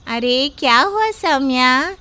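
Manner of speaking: usual speaking voice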